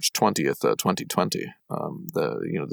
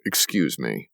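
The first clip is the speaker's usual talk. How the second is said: imitating stiff stranger; 7 semitones lower, 4 dB quieter than usual. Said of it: "showing up and being like".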